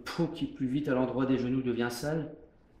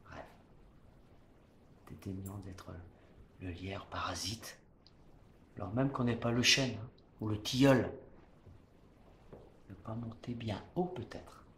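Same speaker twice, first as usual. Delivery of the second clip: softer, lower, inward stage voice; hushed declamation; 3 semitones lower, 8 dB quieter than usual. Acted. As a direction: bring it down to a proud hush. Proud, inward close of tirade.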